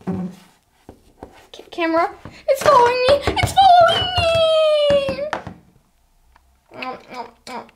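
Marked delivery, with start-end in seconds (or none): 2.5-5.2 mock panic. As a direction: play being chased by the camera. Camera chasing them.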